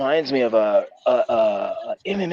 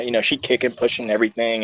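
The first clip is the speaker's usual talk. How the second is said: normal talking voice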